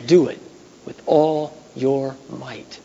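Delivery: ordinary delivery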